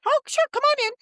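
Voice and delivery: high, loud, gushing welcome; delighted guard greeting. Retold or imitated. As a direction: greet her like a guard thrilled to see an old friend. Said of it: "She goes".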